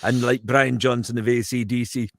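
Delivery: lilting Newcastle Geordie accent. Shown as a demonstration. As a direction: talk like a Geordie from Tyneside. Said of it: "you're now in Newcastle".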